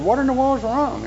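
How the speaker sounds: high, puzzled what's-wrong; baffled asking. Retold or imitated. Parent to the sobbing boy, high and baffled.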